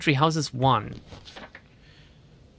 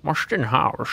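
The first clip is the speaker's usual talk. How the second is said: put-on Marston House in a Sean Connery Scottish accent, rolled and slurred; Scottish accent in the Sean Connery mould; slower than usual. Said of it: "Sean Connery".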